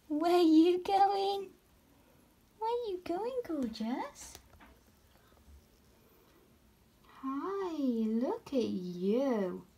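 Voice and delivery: high doting pet-voice. Real, not acted. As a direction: follow the wandering spider with doting coos. Cooing at spider exploring.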